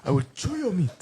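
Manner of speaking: high-pitched put-on African accent; mock African accent. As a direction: lay on an African accent, amused. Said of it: "It's my favorite accent".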